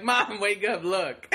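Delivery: put-on playful, insistent kid voice; imitating pestering daughter. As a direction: eagerly rouse a sleeping mom. Acting daughter waking sleeping mother.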